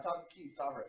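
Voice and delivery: normal talking voice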